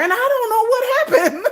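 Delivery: high laughing voice. Real, not acted. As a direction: giggle the words out. Laughing through her own story.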